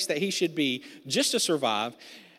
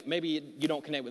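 ordinary delivery

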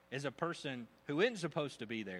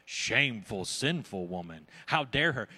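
louder, lower scornful judging; scornful Pharisees; 7 semitones lower, 5 dB louder than usual. Retold or imitated. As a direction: sneer it out like a self-righteous host. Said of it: "They just said".

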